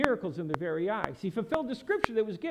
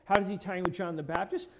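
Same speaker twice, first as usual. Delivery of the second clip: ordinary talk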